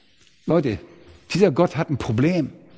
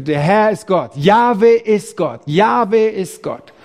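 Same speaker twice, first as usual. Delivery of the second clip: slow, loud, higher acclamation; the people of Israel crying out slowly and loudly; crowd proclaiming; 4 semitones higher, 3 dB louder, slower than usual. Acted. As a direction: cry it out slowly like a whole nation.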